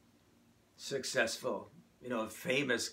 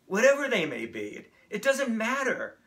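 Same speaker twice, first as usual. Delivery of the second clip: loud, high rallying cry to the congregation; fervent sermon climax; impassioned preaching; 8 semitones higher, 8 dB louder than usual. Real, not acted. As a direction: drive the charge home at full force.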